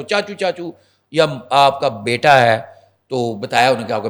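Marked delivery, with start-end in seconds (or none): 0.0-0.7 mock pleading nephew. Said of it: "اگر آپ کا بھتیجا ہے تو".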